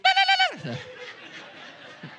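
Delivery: real laugh breaking out high; high burst of laughter. Real, not acted. Preacher laughing at his own airport joke, high laugh. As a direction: laugh at the joke about himself.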